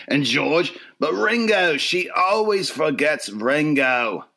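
hammed-up British accent, dragged out at the end. Shown as a demonstration. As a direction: lament it as a mock British bloke. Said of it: "You could do some British, too".

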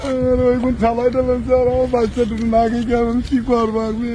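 helpless sobbing plea; crying. Real, not acted. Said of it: "তুই কান্দিস না ভাই".

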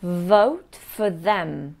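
Vote for them slowly, for with a short weak vowel; patient teacher model; slow demo. Shown as a demonstration. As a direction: draw out vote, keep for light. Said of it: "That's right".

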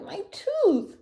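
very high storybook wail; hammed-up high-pitched cry; pained cry. A narrator's reading. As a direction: wail over an aching tooth. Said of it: "he cried".

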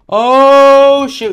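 long, high, very loud cry; startled yell. Real, not acted. Player caught off guard in the game, startled yell. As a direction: burst out loud and high in shock.

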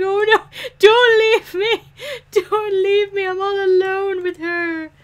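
high, whimpering plea of a lonely man; whiny abandoned sim. Retold or imitated. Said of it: "He's like".

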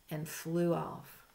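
ordinary delivery, nothing marked

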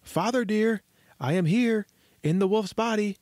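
high little voice calling for father; eager high-pitched hail. A narrator's reading. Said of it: "he called out".

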